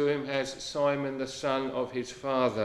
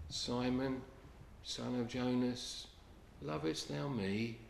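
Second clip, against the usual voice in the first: gracious Lord; 9 dB quieter than usual. Acted. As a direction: ask gently, full of grace. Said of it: "gracious Lord, says to him".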